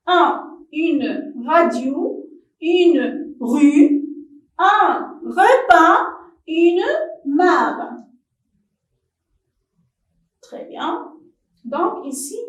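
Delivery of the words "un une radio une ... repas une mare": slow, loud word list for pupils; teacher dictation. Shown as a demonstration. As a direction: read each word out slow and loud for the class. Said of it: "teacher repeats word list".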